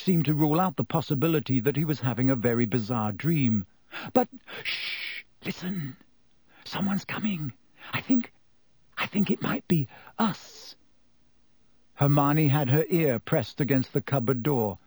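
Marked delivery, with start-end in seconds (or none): 4.1-10.8 hushed unvoiced whisper; hiding in a broom closet, whispering to Harry. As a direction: whisper so no one outside hears.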